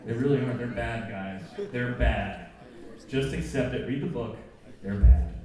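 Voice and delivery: loud, mock-stern verdict; emphatic insistence. Real, not acted. Preacher insisting to students the Hobbit films are bad. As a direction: hammer home that they're bad. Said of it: "insisting Hobbit movies bad".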